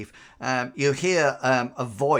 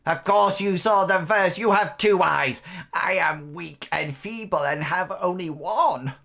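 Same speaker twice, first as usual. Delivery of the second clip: raised, crackling crone voice; aggrieved old hag; 5 semitones higher than usual. Acted. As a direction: plead feebleness as an indignant old hag. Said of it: "a rather crackling old voice".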